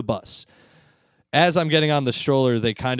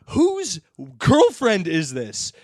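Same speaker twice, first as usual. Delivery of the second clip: slow, higher-pitched challenge; mock exasperated demand; 4 semitones higher, 3 dB louder, much slower than usual. Men to other riders about the woman, mock demanding. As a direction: draw out the question as a mock demand.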